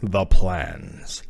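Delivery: deep, sinister villain voice; low, measured mastermind impression; Wesker. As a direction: drop low and savor it like a cool villain. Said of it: "He's gonna be like this".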